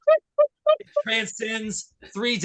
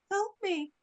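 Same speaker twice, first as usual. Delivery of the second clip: creepy plea; 7 dB quieter than usual. Retold or imitated. Haunted head speaking.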